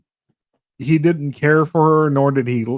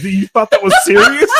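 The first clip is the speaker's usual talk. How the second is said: incredulous; 8 semitones higher, 7 dB louder than usual. Retold or imitated. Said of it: "I was like".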